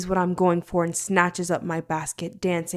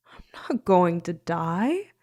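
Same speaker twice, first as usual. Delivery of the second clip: slow, hushed, drugged reassurance; slow dazed whisper to her worried captors; dreamy whisper; slower than usual. Acted. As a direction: whisper it slowly as if floating. Drugged heroine reassures them.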